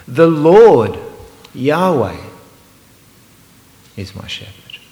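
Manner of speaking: "Lord" ringing high and loud; stress on LORD. Shown as a demonstration. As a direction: proclaim "The Lord" loud, then drop to near hush. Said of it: "I'm gonna emphasise just one at a time".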